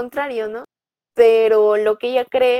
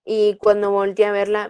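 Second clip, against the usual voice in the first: ordinary talk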